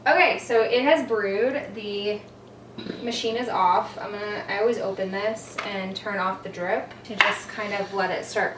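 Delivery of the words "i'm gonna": usual speaking voice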